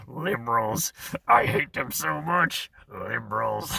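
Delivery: exaggerated put-on rant voice; mock hatred. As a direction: ham up the loathing, dragging out the words. Mock rant about hating liberals.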